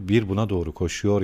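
ordinary delivery

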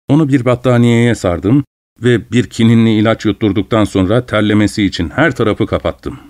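usual speaking voice

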